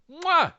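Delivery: loud high smacking kiss; chef's kiss. Real, not acted. Cohost praising the skits with a loud chef's kiss. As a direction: kiss your fingertips loudly.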